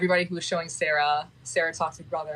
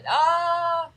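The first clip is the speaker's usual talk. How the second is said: sharp high yelp; 12 semitones higher, 5 dB louder than usual. Real, not acted. Breaks off the fake answer.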